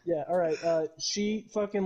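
usual speaking voice

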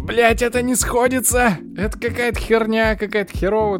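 high, loud frustrated swearing; frustrated outburst. Retold or imitated. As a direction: blurt it out, fed up. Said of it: "я такой".

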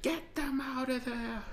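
high, quiet, slow hammy voice; a deliberately terrible actor's line; bad acting. Shown as a demonstration. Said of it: "I'm doing it terribly 'cause I'm a terrible actor".

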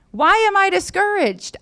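high, insistent question; self-challenging psalmist. Acted. Psalmist talking back to his heart, high and insistent.

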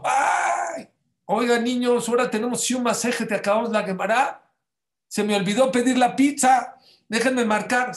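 high, loud playful teacher voice; teacher loudly faking a pizza call to the class; mock teacher on phone. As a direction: ham up the teacher pretending to call. Teacher fakes ordering pizza.

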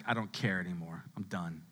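low, soft, given-up voice; numb resignation. Acted. Preacher voicing someone numb after a breakup, resigned. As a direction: say it drained and detached, low and quiet. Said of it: "now you're just like".

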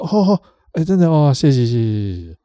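音调拉高，又惊又喜地连声道谢; 像松了一口气般连忙说谢谢; 惊喜道谢. Retold or imitated. 模仿对方惊喜道谢. (模仿对方惊喜地拉高嗓门道谢)

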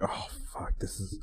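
near-whispered retold dismay; dismayed under-breath mutter. Driver being watched parallel parking, dismayed whispered curse. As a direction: drop to a hushed curse to yourself.

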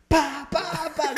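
loud, high "pa" smacks; imitated swatting blows. Mum hitting him with the fly swatter, voiced smacks. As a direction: act out the swats with sharp loud bursts.

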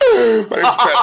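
mock crying, high and loud; Pat. Host mocking his oversensitive co-host, fake crying. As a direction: wail the name in fake tears. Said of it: "you're gonna start crying".

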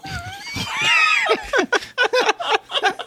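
high shrieking laugh. Real, not acted. Huge laugh after answer.

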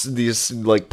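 ordinary delivery

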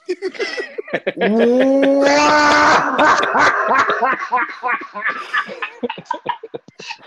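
long loud howling laugh at own joke; huge laugh. Real, not acted. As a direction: lose it completely laughing.

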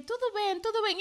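high, concerned question; Portuguese lady voiced. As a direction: mimic a worried stranger checking in. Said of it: "me decía". (señora preocupada, voz aguda)

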